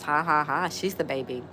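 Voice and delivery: ordinary talk, nothing marked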